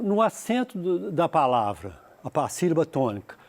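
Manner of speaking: usual speaking voice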